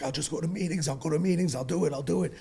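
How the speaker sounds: begging. Retold or imitated. Son begging his mother not to send him away, pleading. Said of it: "I begged".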